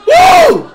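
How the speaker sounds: high, loud yelp; sudden high-pitched cry at the play; shouted "ooh". Real, not acted. Reacción al partido en vivo. (grito agudo de "¡uh!")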